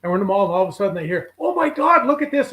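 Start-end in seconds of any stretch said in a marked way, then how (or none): 1.4-2.5 high, loud mock astonishment; excited exclamation. Stranger spotting someone in a mall, excited exclamation. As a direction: imitate someone crying out in amazement. Retold sudden cry across the mall.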